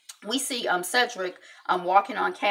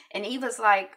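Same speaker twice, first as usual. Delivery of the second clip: ordinary talk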